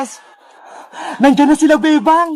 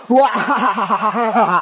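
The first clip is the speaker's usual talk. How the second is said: long, low villain laugh; evil cackle; 8 semitones lower than usual. Acted. Villain told by children to leave, evil cackle. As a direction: cackle over cornered prey.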